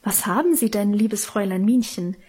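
ordinary delivery, nothing marked

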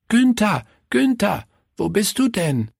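put-on high child's voice calling out for a friend. A narrator's reading. Willi looks for his friend in the happy crowd, calling. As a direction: call out high, searching for your friend. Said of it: "rief Willi".

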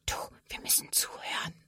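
barely audible voiceless whisper; hushed, embarrassed shushing; very quiet whisper. A narrator's reading. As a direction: whisper a discreet reminder to a friend. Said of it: "sagt Sophia ganz leise zu ihr".

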